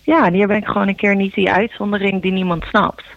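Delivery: usual speaking voice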